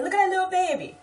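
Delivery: very high, loud squeal of delight; acted excited New Orleans gushing. Speaker gushing over a baby, excited. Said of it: "when I'm excited and I say".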